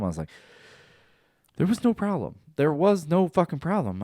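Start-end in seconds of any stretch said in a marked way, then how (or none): 1.6-4.0 exasperated protest. Retold or imitated. Arguing back at girlfriend.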